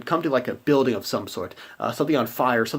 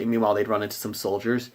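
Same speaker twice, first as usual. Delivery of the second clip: normal talking voice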